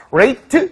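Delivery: "rate" with a full "ay" vowel; "rate" fully voiced as "rayt", not "rit"; verb ending. Shown as a demonstration. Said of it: "이렇게 발음되면 동사형을 얘기해주고요".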